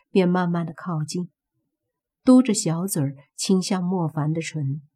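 ordinary delivery, nothing marked

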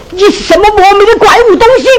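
fast, high contemptuous jeer; scornful insult. Acted. Innkeeper sneering insults at the poor scholar, scornful. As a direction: sneer the insult out quickly and sharply. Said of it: "他骂我是个啥子莫名的怪物东西".

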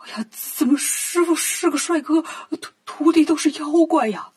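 hammed-up stammering fright; frightened. A narrator's reading. Old man sees the monster disciples, acted stammering fear. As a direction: stutter it out like a scared old man. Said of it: "腿儿都吓软了".

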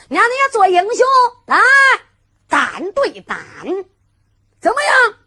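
high, loud taunting dare; loud challenge. Acted. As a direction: call him out to fight one on one. Hero challenges to single combat. (高声挑战)

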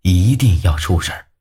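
usual speaking voice

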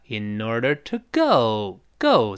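in order climbs, go glides down; rise, then falling go. Shown as a demonstration. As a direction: lift in order, then slide down on go. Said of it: "go再画下来".

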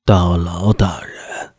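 low, slow, husky rasp; whiskey voice. Acted. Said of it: "用低沉沙哑的声音说道".

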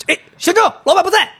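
high, excited shout for the admin; playfully calling out to catch him; mock call-out. Jokingly calling the admin to catch the lying coworker, high excited shout.

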